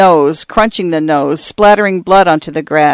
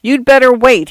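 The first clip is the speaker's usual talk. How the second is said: urgent, high-pitched narrated yell; 7 semitones higher than usual. A narrator's reading. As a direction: call after someone running away. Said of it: "he yelled".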